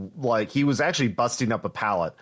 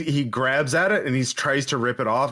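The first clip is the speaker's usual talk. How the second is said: normal talking voice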